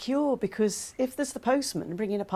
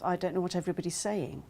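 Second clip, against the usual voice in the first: ordinary talk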